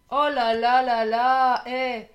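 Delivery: marvelling loudly at the cards; amazed exclamation. Real, not acted. Amazed at the card spread. (un oh là là émerveillé, lent et fort)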